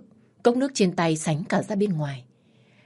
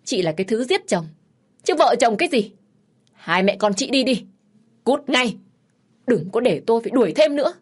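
raised, higher-pitched angry voice; angry outburst; 5 semitones higher, 4 dB louder than usual. A narrator's reading. Woman ordering mother and daughter to leave, angry. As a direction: throw them out with rising indignation.

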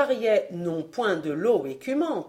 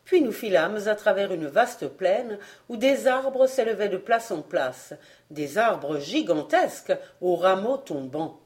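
usual speaking voice

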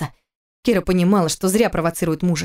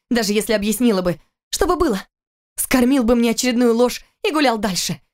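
raised, bitter accusing woman; Kira's angry reproach; 5 semitones higher than usual. Acted. Wife confronting her cheating husband, bitter and raised. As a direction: hurl the betrayal back at him.

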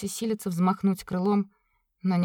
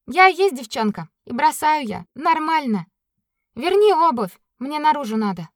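indignant; 7 semitones higher, 6 dB louder than usual. Acted. Katya retorts.

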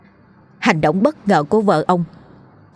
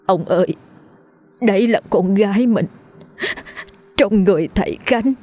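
a stunned mother shaking as she speaks; mother trembling as she tells her husband their daughter is inside the teacher; 3 semitones higher than usual. Acted. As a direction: voice quivering with emotion, rising higher. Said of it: "Bà Lương rung giọng nhìn chồng xúc động".

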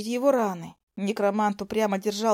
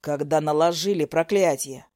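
low male voice; 6 semitones lower than usual. A narrator's reading.

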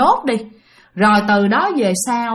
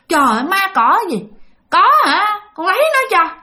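put-on drunken boast; 9 semitones higher than usual. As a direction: brag loosely like a tipsy man. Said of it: "Thì cậu mới nói rằng".

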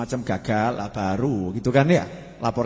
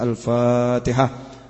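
loud, drawn-out chanted call; slow, ringing cue to prayer; 6 dB louder, much slower than usual. Acted. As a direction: call the congregation to recite, stretching the syllables.

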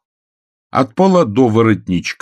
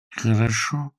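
meek, slightly raised voice; numb with fear; 4 semitones higher, 4 dB quieter, slower than usual. A narrator's reading. Frightened Bream agrees to go for police, meekly. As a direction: answer small and obedient. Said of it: "Страх сковал все чувства Брима Мортимера".

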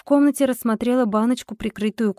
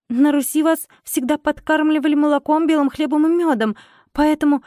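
ritual incantation; 5 semitones higher than usual. A narrator's reading. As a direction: recite it like an old folk spell. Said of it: "произнесла я".

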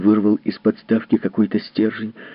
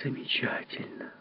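whispered, higher, awed; breathless wonder; 7 semitones higher, 14 dB quieter, slower than usual. Acted. Wife hears of the time viewer, breathless whisper. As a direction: hands to chest, whisper in awe. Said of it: "прижала к груди стиснутые руки".

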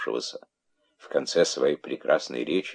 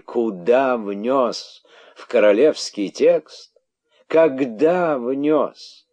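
indignant tirade; 6 semitones higher, 5 dB louder than usual. A narrator's reading. King berating the herald about the royal text, indignant. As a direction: hammer out rhetorical questions in outrage.

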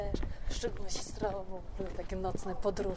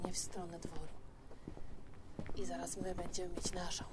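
quiet at night; 3 semitones lower, 7 dB quieter than usual. Real, not acted. Walking outside at night.

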